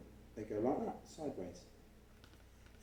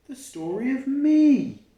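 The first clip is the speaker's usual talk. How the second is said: slow, loud and high, a proud announcement; excited reveal; 13 semitones higher, 13 dB louder, slower than usual. Acted. Child tells sister the story is found, proudly excited. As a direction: savour the big reveal. Child's big discovery line.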